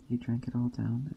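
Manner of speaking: plain reading voice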